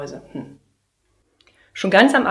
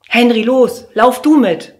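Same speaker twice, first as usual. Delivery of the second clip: loud, higher hurried orders; urgent teacher command; 6 semitones higher, 12 dB louder, slower than usual. Acted. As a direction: hurry them out the door. Teacher urges Henry. (laute Lehrerin, drängend)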